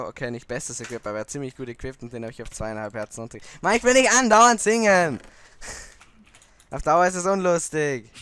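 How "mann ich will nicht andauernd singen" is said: loud, fast frustrated complaint; frustrated outburst. Real, not acted. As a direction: vent it loudly and quickly. Frust über Strafe, laut. (lauter, schneller Frustausbruch)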